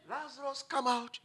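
Lazarus, come out said softly; preacher voicing Jesus gently calling the dead; gentle call. Shown as a demonstration. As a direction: call him quietly out of the tomb. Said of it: "Jesus didn't scream".

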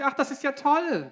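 high, slow, gushing delight; gushing witch. Retold or imitated. Interpreter echoes the witch's delight, slow and high. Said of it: "Und dann sagt sie".

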